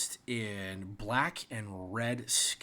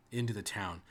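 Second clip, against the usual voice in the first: usual speaking voice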